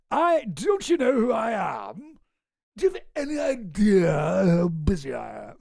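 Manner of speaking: hammed-up, self-important outburst; imitating a sudden indignant yell; mock indignant shout. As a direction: shout it like an offended big shot, overdone. Acted sudden outburst of mocked Elon.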